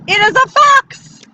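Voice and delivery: high, loud triumphant announcement; excited reveal. Acted. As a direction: proclaim it like a grand surprise. Woman proudly reveals the watcher.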